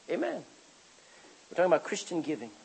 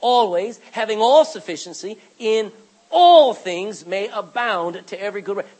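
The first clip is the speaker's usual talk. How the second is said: loud, slowed, all stressed; forceful scripture reading; 10 dB louder than usual. Real, not acted. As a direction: hammer the verse home. Rereading verse with force.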